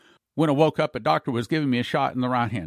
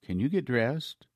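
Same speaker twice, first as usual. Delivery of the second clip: softer, lower, slow humble plea; 4 semitones lower, 6 dB quieter, slower than usual. Retold or imitated. Colonel now humble toward the prisoner he beat, quiet and slow. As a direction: speak it meekly, almost apologizing. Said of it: "with a very humble voice".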